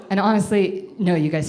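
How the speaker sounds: ordinary talk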